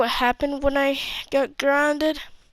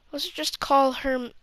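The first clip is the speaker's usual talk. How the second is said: normal talking voice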